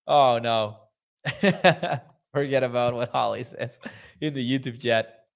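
giggling through the words; laughing. Real, not acted. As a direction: laugh while reading the chat. Laughing at Holly's comment.

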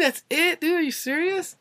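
high-pitched mock shock at the price; stunned disbelief. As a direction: pitch way up, can't believe it. Retelling the low goat price.

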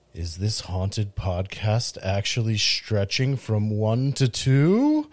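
slow, low haunted-house narrator; ghost host narration. Acted. Haunted Mansion Ghost Host spiel.

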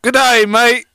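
high, loud mock Australian greeting; a cheery Aussie hello, hammed up; Australian accent. Friend doing a mock Australian accent on the podcast. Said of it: "That's Australian".

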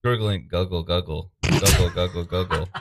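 gurgling alien voice. Acted. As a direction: gargle like an alien. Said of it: "making gargling noises".

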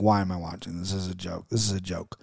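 low, soft, dismissive mumble imitating a bored critic; mock bored critic. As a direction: grumble it like an unimpressed media member. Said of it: "every media member was like".

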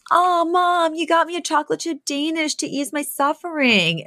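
high, put-on voice, playing the daughter; sweetly milk the moment as Fran; Fran's high voice thanking her mom; whiny Fran voice.

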